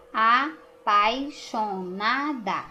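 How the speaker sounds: slow, "apaixonada" broken into syllables. Shown as a demonstration. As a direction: separate "apaixonada" into syllables slowly. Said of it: "Do jeitinho que a gente fala".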